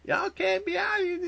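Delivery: high put-on neighbor lady yelling; mock angry neighbor lady. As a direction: bark it like a crazy neighbor. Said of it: "somebody going the fuck off".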